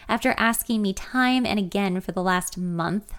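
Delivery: usual speaking voice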